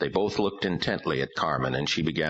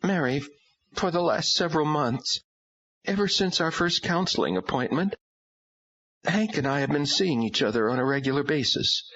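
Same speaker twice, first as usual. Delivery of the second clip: tearful confession; 12 semitones higher than usual. A narrator's reading. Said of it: "her eyes filling with tears".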